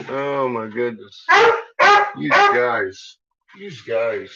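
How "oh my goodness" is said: ordinary talk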